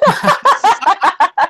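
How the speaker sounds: very high, loud, long stretched "that"; drawn-out high squeal; mock squeal. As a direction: squeal the word in mock shock. Exaggerated shrill mock squeal.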